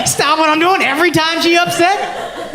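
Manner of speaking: high, incredulous laughing rant; mock outrage at his girl; hammed-up incredulous; exasperated. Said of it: "I'm just like".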